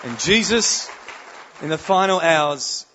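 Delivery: loud, high preacher's cry; forceful preaching. Real, not acted. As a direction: ring it out to the whole congregation. Preacher bursts into full force.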